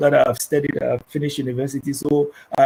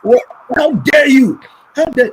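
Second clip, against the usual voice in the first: mock outraged parent, much higher and louder; hammed-up indignant shout at the child; offended parent; 11 semitones higher, 8 dB louder than usual.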